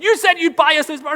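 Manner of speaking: put-on high, loud accusing kid voice; a child holding dad to his promise; whiny kid voice. Daughter whining at dad over a broken promise.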